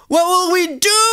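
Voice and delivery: very high, fretful question; scared. A narrator's reading. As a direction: ask it in mock panic. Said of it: "The Golly sisters are scared".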